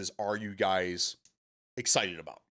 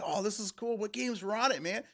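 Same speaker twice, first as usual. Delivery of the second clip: high, imitated kid's enthusiasm; mock kid excitement; excited kid; 9 semitones higher than usual. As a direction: imitate an eager kid, pitched up. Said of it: "this one kid just comes to me".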